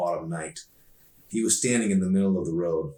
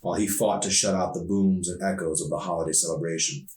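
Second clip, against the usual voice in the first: ordinary delivery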